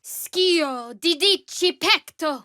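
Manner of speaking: high, loud, slow spell chant; slow shouted incantation. Acted. Casting a spell, slow shouted incantation. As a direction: chant the Latin slowly and loudly.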